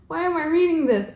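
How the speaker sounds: mock-exasperated, high-pitched wail; retold frustration, pitched well up; pissed off. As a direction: complain in playful despair. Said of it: "I was really pissed off".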